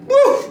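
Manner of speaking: sudden very high, loud woo; high woo of exasperation. Real, not acted. Narrator remembering his rage, a high loud woo. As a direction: let the anger out in a shrill-free high whoop. Recalling fury.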